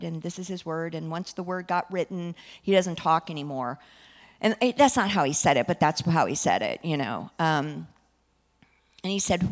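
usual speaking voice

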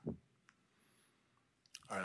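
ordinary talk

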